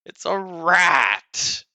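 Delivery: slow, high, loud protest; exasperated outburst. Acted. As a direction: stretch it out in loud disbelief. Exasperated argument over sparing rat.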